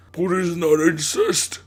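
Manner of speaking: put-on high, loud, slow clueless girl; dim-witted Mary voice. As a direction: ask it like a confused dunce. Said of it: "Mary was a dunce".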